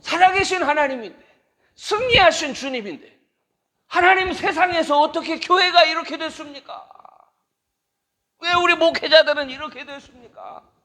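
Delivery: high, loud anguished plea to God; impassioned lament prayer. Acted. The preacher laments to God over the church, loud anguished plea. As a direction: cry out to God about the church.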